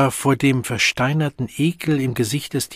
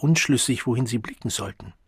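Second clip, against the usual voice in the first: ordinary delivery, nothing marked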